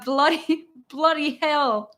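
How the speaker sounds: mock British swearing; amused try at British; attempted British accent. Shown as a demonstration. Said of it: "British English pronunciation".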